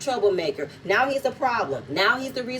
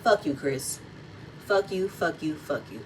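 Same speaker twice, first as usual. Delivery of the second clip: muttered curse; 6 semitones lower, 6 dB quieter than usual. Real, not acted. Vlogger cursing at Chris.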